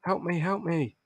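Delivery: high, unforced pleading; mock plea. Wounded character calls for help.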